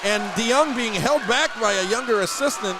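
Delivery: loud, high-pitched aftermath call; excited play-by-play. Real, not acted. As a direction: keep calling it loudly over the crowd. Announcer still excited after the basket.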